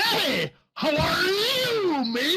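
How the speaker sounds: high, slow drawn-out pirate greeting; pirate accent. Acted. GM voicing the drunk pirate greeting the player, high pirate accent. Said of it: "so he's like".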